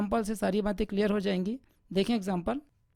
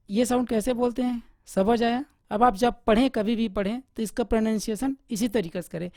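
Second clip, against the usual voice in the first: usual speaking voice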